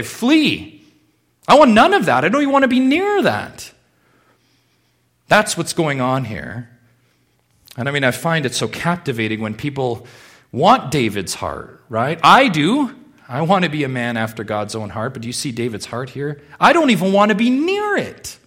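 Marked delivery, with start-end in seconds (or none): 0.0-3.7 high, raised cry of refusal; urgent refusal. Acted. Preacher voicing someone fleeing temptation, urgent refusal. 16.6-18.4 high, loud recoil; vehement refusal. Acted. Preacher voicing David's heart to the congregation, vehement refusal.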